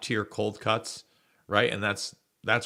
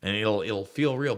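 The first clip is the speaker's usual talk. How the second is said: ordinary talk, nothing marked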